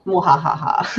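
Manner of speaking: villainous mwahaha cackle; evil laugh. Pretend. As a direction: gloat like a mad scientist. GM gloats controlling sheet.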